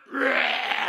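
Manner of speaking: loud raw roar of frustration; furious wordless shout; angry roar. Retold or imitated. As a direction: bellow it out in rage. Angry outburst at pastor.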